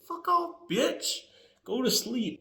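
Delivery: high mocking brush-off, a bit soft. Townsmen brushing off Lot, mocking high voice. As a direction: sneer it up high like dismissive townsmen.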